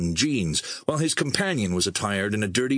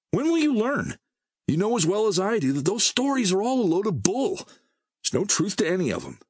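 exasperated lecture; 11 semitones higher than usual. Acted. Merrill scolding Emerson for believing stories, high exasperated rant. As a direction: hammer him with the point. Merrill berating his friend.

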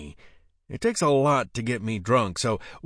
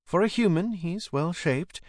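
lightly voiced angel, pitched higher; a higher voice for the angel, slowing at the end; guardian angel; 7 semitones higher than usual. A narrator's reading. Guardian angel replying about his looks, lightly voiced in higher pitch. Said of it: "my guardian angel replied".

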